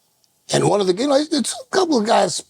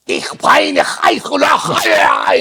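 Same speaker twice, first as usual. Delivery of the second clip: harsh unvoiced mock German shouting; mock German screaming at the door; angry German woman; 9 semitones higher, 4 dB louder, much slower than usual. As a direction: spit out fake angry German. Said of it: "she's hot, man, screaming".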